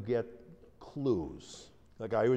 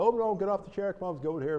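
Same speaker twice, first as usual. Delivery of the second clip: much higher, louder panicky coaxing; flustered fuss; 12 semitones higher, 5 dB louder, faster than usual. Retold or imitated. Team fussing over a writhing woman, high flustered coaxing. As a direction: rush in fussing over her. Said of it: "we would have said".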